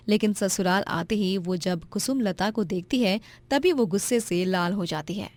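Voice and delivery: ordinary delivery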